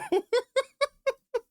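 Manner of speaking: a high, bursting laugh of disbelief; excited laugh. Real, not acted. Reacting to a song that gave him chills, laughing. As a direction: laugh out loud, thrilled.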